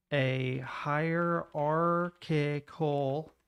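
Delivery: slow dictation. Real, not acted. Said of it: "did I spell that right?".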